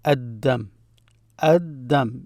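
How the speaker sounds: Ad-Dam with the L replaced by a held D; silent L. Shown as a demonstration. Teacher repeating for learners, Ad-Dam without the L. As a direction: double the D in Ad-Dam. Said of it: "I did not pronounce the L there".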